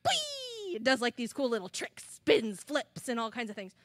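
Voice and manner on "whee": much higher, eager showing-off call; a proud grasshopper wanting to be watched; Murray showing off. Acted. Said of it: "Murray is like".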